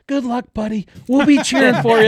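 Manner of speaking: high, loud mock drunk woman; hammed-up drunk lady. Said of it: "She's like".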